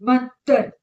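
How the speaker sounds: बंदर loud, nose held closed; nose pinched shut. Shown as a demonstration. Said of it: "हम नाक अपना बंद करेंगे". (नाक बंद करके बंदर)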